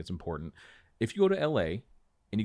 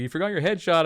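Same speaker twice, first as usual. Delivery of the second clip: calling after; 11 semitones higher, 11 dB louder than usual. Retold or imitated. Guy calls after him leaving.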